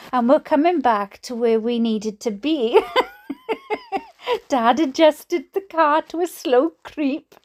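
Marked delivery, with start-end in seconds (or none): 3.3-4.0 sudden high-pitched giggle; a breathless, squeaky laugh; high squeal of laughter. Real, not acted. Laughing at the memory.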